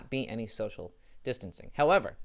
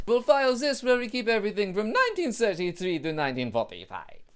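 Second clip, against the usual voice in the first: hammed-up German accent, higher and louder; bad German accent; 9 semitones higher, 7 dB louder than usual. German official filing Merkel calls with the Nazi archive, bad German accent. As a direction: push a clumsy German accent through the punchline. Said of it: "I can't do German accent".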